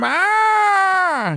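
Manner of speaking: high shouted meow like a bleating lamb; loud cat meow. Retold or imitated. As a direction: yowl it like an upset cat. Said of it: "Sounds like a fucking lamb".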